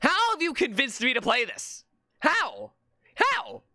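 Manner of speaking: high, climbing disbelief; incredulous gamer freak-out; exasperated outburst. Real, not acted. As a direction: demand an answer, pitch leaping up each time. Berates chat over the game.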